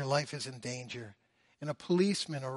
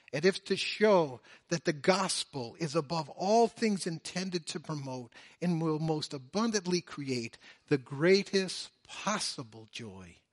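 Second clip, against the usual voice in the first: ordinary talk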